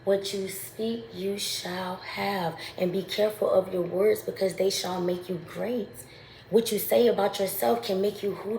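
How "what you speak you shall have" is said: slow and softer, like reciting scripture; hushed, slow proclamation; solemn slow quote. Real, not acted. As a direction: lay each word down slowly and gravely. Echoes a scripture-like saying.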